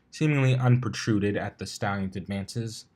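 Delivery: usual speaking voice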